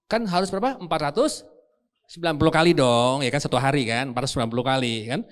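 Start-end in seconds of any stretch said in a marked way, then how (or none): none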